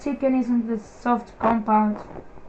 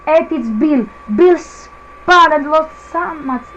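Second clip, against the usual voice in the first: excited; 4 semitones higher, 9 dB louder, slower than usual. Real, not acted. Rival spins during race.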